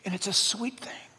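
soft unvoiced whisper. Real, not acted. Preacher to his church, a tender real whisper. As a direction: breathe it out as a hushed whisper. Tender aside about praising God.

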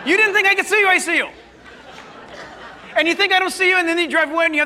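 high, loud playful teasing. Real, not acted. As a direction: catch them out, laughing along. Teasing congregation about driving past.

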